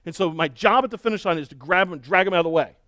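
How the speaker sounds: ordinary talk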